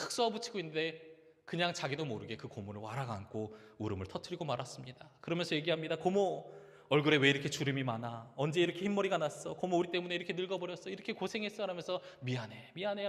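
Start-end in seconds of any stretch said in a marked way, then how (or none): 6.0-13.1 tearful apology. Retold or imitated. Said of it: "그렇게 펑펑 울어버렸어요".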